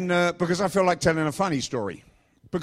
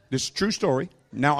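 ordinary delivery

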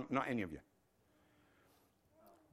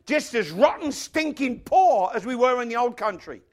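raised, forceful hit on "rotten, stinking poor"; emphatic preaching; 9 semitones higher, 11 dB louder than usual. Real, not acted. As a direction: punch it out emphatically to the congregation. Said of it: "I wish I hadn't said that quite emphatically".

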